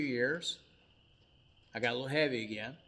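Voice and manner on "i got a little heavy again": normal talking voice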